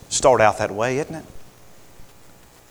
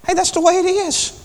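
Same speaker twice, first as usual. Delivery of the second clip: put-on very high, singsong kid voice; cocky shrug of a voice; a peer brushing it off in a high mocking voice; mock tempter.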